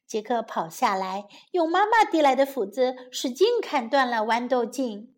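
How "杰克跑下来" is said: usual speaking voice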